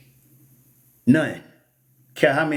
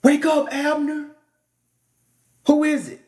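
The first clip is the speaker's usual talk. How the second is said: mock scream; 10 semitones higher than usual. David yelling down at Abner's camp, high mock scream. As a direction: call out like waking a camp. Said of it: "So he screamed out".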